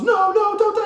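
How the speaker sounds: very high, loud wail; hammed-up desperate cry; acted frantic plea. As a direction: beg in a mock panic. Po pleads with master.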